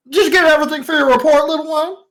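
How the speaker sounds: high and fast, doting on a little one; sweet creature voice. Acted. Creature asks the little one about the report, high and quick. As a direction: coo at the child quickly and high. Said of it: "And you see it goes".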